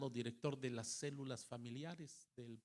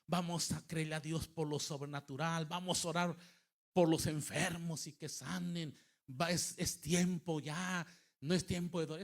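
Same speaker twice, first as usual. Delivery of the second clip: raised, loud rallying exhortation; exhorting preacher; 4 semitones higher, 6 dB louder than usual. Retold or imitated. Pastor urging his congregation to rise and pray, exhorting. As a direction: rouse the congregation with an urgent lifted voice. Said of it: "y yo les dije".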